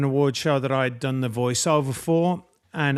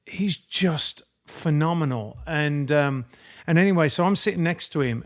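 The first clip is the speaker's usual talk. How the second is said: ordinary talk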